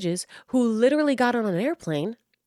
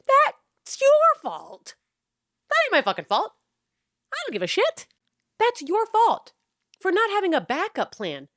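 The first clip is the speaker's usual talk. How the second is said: outraged; 12 semitones higher than usual. Real, not acted. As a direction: hurl the blame at them. Ranting back at creators.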